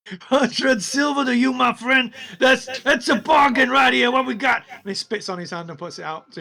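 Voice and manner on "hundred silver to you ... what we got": high, loud goblin pitching junk; goblin hawker. Acted. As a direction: sell rubbish like a pushy goblin. Goblin keeps bargaining.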